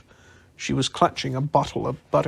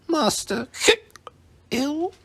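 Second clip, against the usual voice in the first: very high, trembling elf cry; distressed elf squeak. A narrator's reading.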